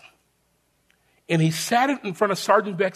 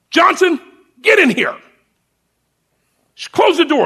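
much higher, louder drill sergeant summons; barking sergeant; 10 semitones higher, 7 dB louder than usual. Retold or imitated. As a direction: bark the name down the hall. Sergeant calls recruit into office.

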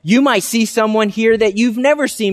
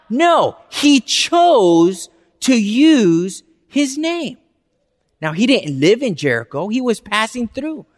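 ordinary talk

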